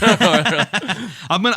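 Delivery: laughing through the words. Real, not acted. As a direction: speak while laughing. Laughing while starting to speak.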